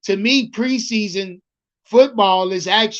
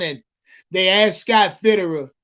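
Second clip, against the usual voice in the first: ordinary delivery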